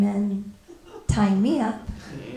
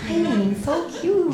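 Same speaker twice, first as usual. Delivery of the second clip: slow, higher-pitched cutesy coo; trying to be cute; 5 semitones higher, slower than usual. Shown as a demonstration. Comedian trying to make fantasy look cute, cooing. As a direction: coo it with drawn-out sweetness. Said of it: "look cute, but I'm gonna try".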